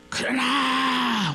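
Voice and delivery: high, long-held cry; preacher's cry. Real, not acted. Preacher cries out the key word.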